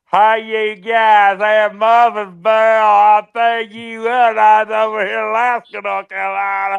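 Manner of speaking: exaggerated, loud, high, very slow drawl; hammed-up mocking accent, dragged out and hollered; Stevie. Teasing a friend about his accent, hammed-up loud high impression. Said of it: "Stevie sounds more like this".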